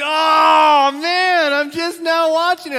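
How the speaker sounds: dismayed outburst. Retold or imitated. Pastor reenacting his reaction to a spoiler text, loud and high. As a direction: act out the groan at a spoiled game. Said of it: "And I'm like".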